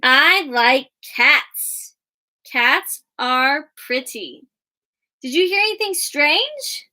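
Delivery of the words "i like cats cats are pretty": slow, evenly spaced words; slow choral reading. Shown as a demonstration. Teacher reading the sentences aloud with the class, slowly. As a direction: read it together slowly. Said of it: "let's read it together".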